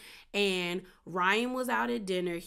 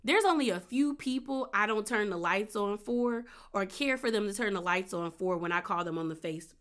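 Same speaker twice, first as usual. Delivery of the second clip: usual speaking voice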